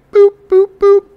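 high squeaky beep noises; scanner beeps. Retold or imitated. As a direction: chirp like a checkout scanner. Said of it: "Lackluster checkout".

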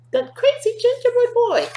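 squeaky high repeat; the teacher repeats the line to the kids, squeaky high; high mock shriek. Repeated higher take.

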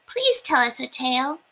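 put-on high pleading little-boy voice; little mice begging for a story; mouse boys asking. A narrator's reading. Sons asking Papa, high and eager.

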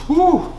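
high loud whoop at the heat; spicy woo. Real, not acted. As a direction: shriek a woo from the burn. Reacting to spicy wing.

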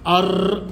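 أر trilled long with no l; silent lam. Shown as a demonstration. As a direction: roll straight into a doubled r on أر. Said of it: "بقول أر". (أر براء مشددة دون نطق اللام)

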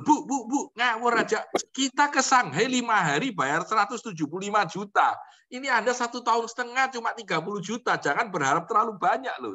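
hammed-up plea to a friend; excited high teasing warning; retold pleading. Retold pleading phone call.

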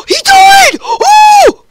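high, loud shriek of surprise; excited shout. Real, not acted. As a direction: scream with glee as he dies. Excited at kill.

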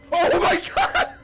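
high, loud, slow laughing cry; laughing shout. Real, not acted. Laughing disbelief after mistake.

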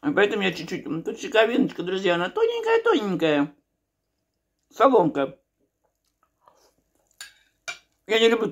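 talking between bites; chewing while talking. Real, not acted. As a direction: mouth partly full. Seen 1: Bite taken at -4; lips tight, chewing motions while showing slice.

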